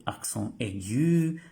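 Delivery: "accent aigu" said slowly in French sounds; French term. Shown as a demonstration. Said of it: "E, acento". ("accent aigu" dicho despacio en francés)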